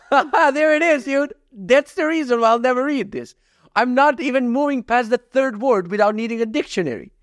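high, breaking into laughter; can barely hold the laugh in. Real, not acted. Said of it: "try not to laugh challenge".

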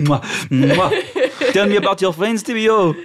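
high, doting French-tinged gnome. Acted. Gnome relative dotes on returning Tibio, high and fond.

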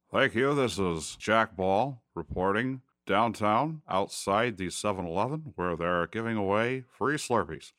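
a serious anchor reporting nonsense; grizzled newscaster voice. Shown as a demonstration. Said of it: "a newscaster voice".

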